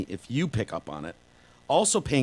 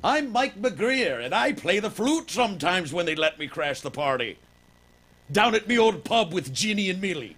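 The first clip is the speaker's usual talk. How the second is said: mock Irish accent; 8 semitones higher than usual. Introducing himself as the flute-playing brother in mock Irish. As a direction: ham up a Liverpool-turned-Irish accent. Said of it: "now you're going Irish".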